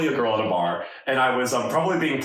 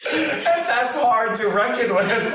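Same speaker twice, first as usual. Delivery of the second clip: high, slow laughing through the words; 10 semitones higher, much slower than usual. Real, not acted. Laughing as he admits he is turning thirty.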